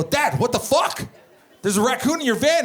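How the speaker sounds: high-pitched retold alarm. Alarmed son confronting dad about raccoon.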